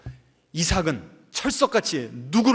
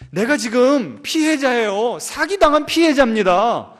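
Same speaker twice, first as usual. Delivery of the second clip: indignant; 7 semitones higher, 6 dB louder than usual. Acted. Preacher playing the wronged victim, indignant and loud. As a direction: cry out as the wronged one. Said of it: "preacher voicing victimhood".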